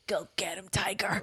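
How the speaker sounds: soft, whispered pep talk; whispered encouragement. Retold or imitated. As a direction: whisper it like a gentle send-off. Acts out patting her head.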